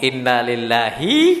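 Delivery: low, drawn-out phrase rising at the end; slow prompting. Retold or imitated. Husband coaching wife to accept the loss, slowly prompting. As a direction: stretch it out as a patient model to be repeated.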